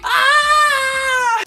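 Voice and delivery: high sung squeal of joy; delighted squeal. Real, not acted. Seeing the drawing on the back, squealing with joy. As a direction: squeal like a thrilled fan.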